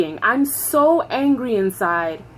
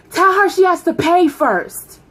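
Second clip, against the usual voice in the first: high, loud holler down a hallway; supervisor yelling; 7 semitones higher, 5 dB louder than usual. Retold or imitated. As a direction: bellow it from four doors away. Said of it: "I hear her from four doors down".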